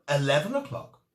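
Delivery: usual speaking voice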